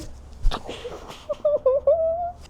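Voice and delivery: very high, squealing giggle; high embarrassed laughter; cringing laugh. Real, not acted. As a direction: giggle helplessly after a cringey line. Laughs after the cringey quote.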